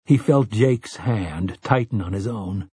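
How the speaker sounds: ordinary delivery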